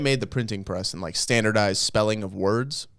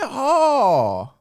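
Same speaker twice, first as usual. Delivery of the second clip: cowboy holler; 11 semitones higher, 3 dB louder than usual. Pretend. Yeehaw about Florida cowboys.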